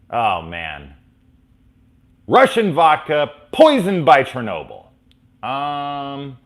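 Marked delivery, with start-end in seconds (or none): 2.3-4.9 higher, louder quoted line; movie quote. Retold or imitated. Fan quoting Scrooged, raised voice. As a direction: toss off the favorite quote.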